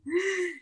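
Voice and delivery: short high gasp of pain; high sharp gasp. Retold or imitated. Reliving the kick to the head, sharp gasp. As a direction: gasp as if hit again. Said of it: "I was like".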